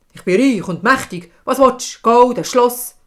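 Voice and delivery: loud, commanding offer of riches; proud king. Acted. King offering the hag gold, loud and proud. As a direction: push the offer with royal force.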